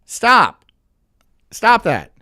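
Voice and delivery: short, loud scolding command. Real, not acted. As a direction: cut them off with a loud order. Scolding the woman in the clip.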